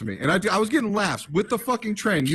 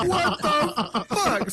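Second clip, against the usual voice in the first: slow, high, laughing through the words; laughing disbelief; 11 semitones higher, much slower than usual. Real, not acted. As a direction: stretch it out while still cracking up. Laughing at the backhanded compliment.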